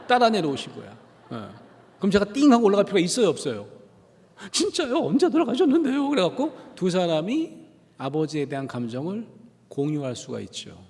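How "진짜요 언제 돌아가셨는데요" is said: high, bright-eyed surprise; surprised sympathy. Retold or imitated. Speaker reacts to the pastor's loss in high surprise. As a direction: jump way up in caring surprise.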